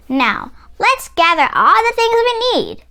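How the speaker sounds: put-on rally the child to get going; eager exclamation. A narrator's reading. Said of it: "she exclaimed".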